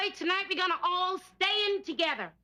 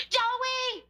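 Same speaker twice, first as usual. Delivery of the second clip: sharp call; 7 semitones higher than usual. Acted. Mother calling son sharply.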